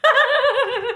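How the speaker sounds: high giggly voice through laughter; laughing. Real, not acted. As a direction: laugh while talking. Laughing at complaint.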